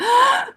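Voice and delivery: put-on sharp child's gasp of amazement; wide-eyed gasp of wonder; high excited gasp. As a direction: gasp like a thrilled kid. Daughter amazed by pencil.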